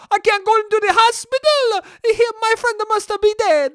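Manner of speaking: high, loud hammed-up Italian accent; over-the-top Italian caricature, shouting; crazy Italian dude. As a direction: panic like a cartoon Italian. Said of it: "some crazy Italian dude".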